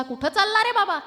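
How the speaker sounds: cheery shout to a passing friend; calling out. Acted. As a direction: hail a friend from a distance. Friend calls out in market.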